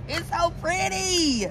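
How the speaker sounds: real giddy admiration; delighted. Real, not acted. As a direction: gush over something pretty. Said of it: "Sorry, shiny object".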